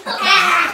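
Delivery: loud scream at the cold; sudden yelp of cold shock. Real, not acted. Sits down in ice water.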